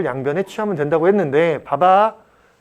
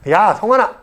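raised, louder shout to a student; calling out; 5 semitones higher, 4 dB louder than usual. Real, not acted. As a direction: call a student sharply to attention. Calls out to a student by name.

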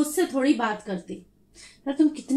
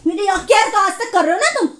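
ordinary delivery

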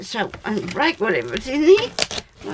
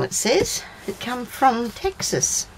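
ordinary talk

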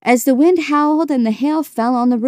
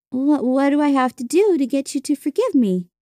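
put-on higher-pitched, girlish pleading question; lightly voiced girl seeking forgiveness; pleading older sister; 5 semitones higher than usual. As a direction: beg to know, voice lifted.

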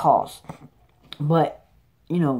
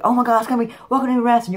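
much higher, faster, louder hype voice; Xavier's voice; 7 semitones higher, 3 dB louder, faster than usual. Retold or imitated. Host showing fans the new voice, high and fast. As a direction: squeal it out like an overexcited announcer. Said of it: "instead of this voice".

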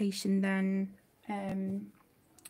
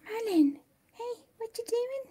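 pet talk; 11 semitones higher than usual. Real, not acted. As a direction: coo at the cat, asking what it's doing. Talking to the cat again.

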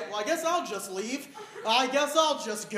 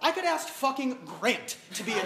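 usual speaking voice

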